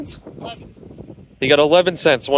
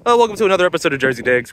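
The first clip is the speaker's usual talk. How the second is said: hammed-up TV host, higher; news reporter voice; 8 semitones higher than usual. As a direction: announce him like a newscaster. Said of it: "I've been talking to Pull Tab in a news reporter voice".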